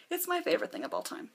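pitched high, rushing with love; can't contain the fandom; gushing fan. Real, not acted. Fan gushing to viewers about How to Train Your Dragon, excitedly.

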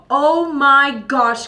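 loud, drawn-out, high excited cry; gasp, then stretch it out loud; thrilled, slow and big after a gasp; excited outburst. Real, not acted. Sudden excitement over nearing 40K subscribers.

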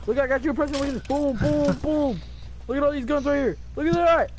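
pet talk. Pretend. Showing Chug a present, high voice.

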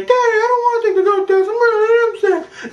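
put-on very high squeaky pleading child; mock whining kid. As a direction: whine to daddy in a put-on little voice.

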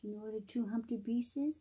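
plain reading voice

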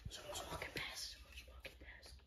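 urgent hushed whisper. Real, not acted. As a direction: breathe it, don't voice it. Hiding, whispering warning.

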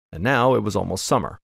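plain reading voice

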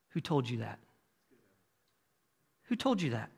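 very soft, inward question; hushed self-questioning. Acted. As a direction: ask it quietly, as if to yourself. Quiet question asked of oneself.

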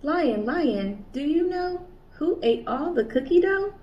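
curious kangaroo asking. Acted. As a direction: call out to the animal, curious. Kanga asking each animal.

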